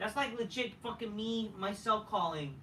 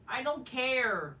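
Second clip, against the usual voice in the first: normal talking voice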